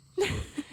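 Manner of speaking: short high teasing giggle. Acted. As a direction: giggle at the innuendo. Teasing about sleeping together.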